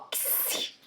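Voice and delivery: "ksi" said as a crisp k then s; ksi sound. Shown as a demonstration. Teacher sounding out "ksi" for students. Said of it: "Olha como que a gente fala o X".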